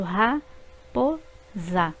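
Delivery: Ra-po-za said very slowly in three beats; syllables split apart, slow. Shown as a demonstration. Teacher repeating Ra-po-za slowly for pupils. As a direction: drag out Ra-po-za one syllable at a time. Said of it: "São três sons que eu emito".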